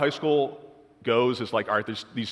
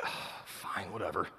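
grudging mumble; 4 semitones lower, 11 dB quieter, slower than usual. Retold or imitated. Reluctant reply to church invite.

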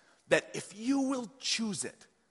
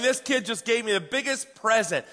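ordinary delivery

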